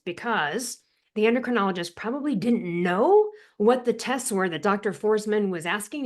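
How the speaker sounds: ordinary talk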